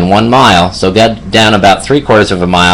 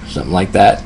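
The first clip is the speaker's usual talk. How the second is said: ordinary talk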